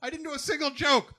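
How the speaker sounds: high, desperate disbelief; panicked. Pretend. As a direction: wail it in mock despair. Voiced panic, repeated.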